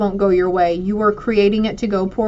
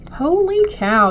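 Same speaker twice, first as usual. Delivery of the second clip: high, slow gasp of delight; surprised; 9 semitones higher, slower than usual. Real, not acted. Reader amazed by the card she just drew, high and slow. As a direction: blown away by the card.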